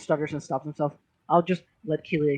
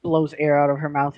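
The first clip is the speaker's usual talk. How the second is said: ordinary talk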